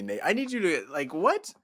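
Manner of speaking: normal talking voice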